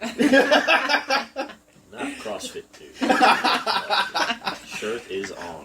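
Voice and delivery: high-pitched words cracking into laughter; laughing. Real, not acted. Guest teasing about CrossFit, laughing high through the words. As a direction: giggle through the joke.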